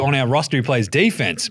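ordinary delivery

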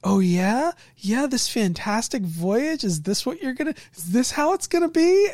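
high, incredulous complaint; playful mock protest; mock indignant players.

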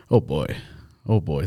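low, soft, understated unease; nervous. Retold or imitated. Hosts learning who listens, retold mild nerves. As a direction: retell it quietly, a touch uneasy. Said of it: "That made me nervous".

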